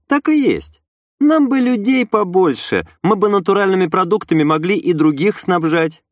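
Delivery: high-pitched proud woman's voice; red-haired woman, proud. A narrator's reading. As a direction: agree proudly in a high character voice.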